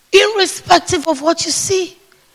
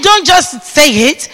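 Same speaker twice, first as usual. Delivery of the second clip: ordinary talk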